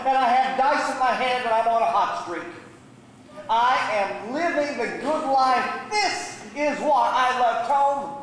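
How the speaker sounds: high, incredulous and brash; scoffing boast. Acted. Younger brother mocking the call home, boastfully. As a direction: brag grandly, stretching the big claims. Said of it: "The younger brother laughed and he said".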